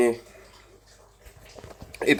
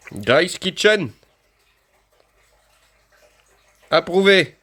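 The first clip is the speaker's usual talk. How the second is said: announcer voice; 7 semitones higher, 6 dB louder, much slower than usual. Acted. Reading the label in a voice.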